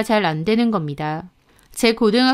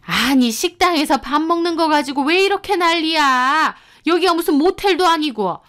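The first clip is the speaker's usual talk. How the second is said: high-pitched, exasperated scolding; indignant mother-in-law; 7 semitones higher than usual. Acted. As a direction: brush off the complaint as needless fuss. Mother-in-law dismisses wife's complaint. (역정 내는 시어머니 목소리)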